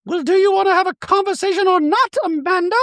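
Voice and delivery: high, prim voice for Dorit; Dorit impression. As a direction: play Dorit sweetly apologetic in a high voice. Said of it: "Dorit speaking to Amanda".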